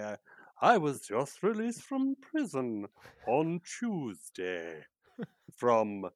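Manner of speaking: slow, low horror-film villain voice; Boris Karloff voice. Retold or imitated. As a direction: drag out each word like a creepy old movie monster. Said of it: "when I get to do my Boris Karloff impression".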